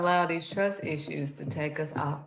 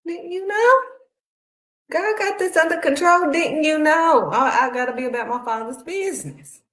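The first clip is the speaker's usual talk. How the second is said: put-on young Jesus; 9 semitones higher, 4 dB louder than usual. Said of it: "he's like".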